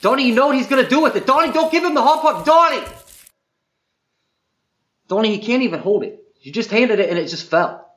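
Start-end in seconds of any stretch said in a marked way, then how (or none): 0.0-2.8 loud, high, fast protest building to a yell; frantic raised-voice scolding; exasperated shouting. Acted. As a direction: try desperately to stop him, louder each time. Exasperated protest to stop Donnie.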